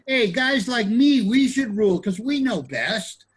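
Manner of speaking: high, loud mock pitch for himself; self-promoting philosopher. Philosopher pitching himself as ruler, high mock boast. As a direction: pitch yourself up high as the one who knows best. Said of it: "He was a philosopher".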